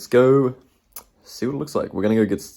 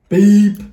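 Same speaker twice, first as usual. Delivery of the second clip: mock censor beep; 10 semitones higher, 8 dB louder than usual. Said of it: "Maybe bleep that".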